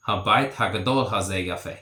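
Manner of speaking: Habait hagadol hazeh yafe run together quickly in one breath; fluent full-speed sentence after the breakdown; at natural speed. Shown as a demonstration. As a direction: say it the way a native would, fast.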